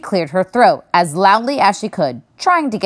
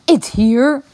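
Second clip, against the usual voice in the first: surprised bear; 6 semitones higher than usual. A narrator's reading.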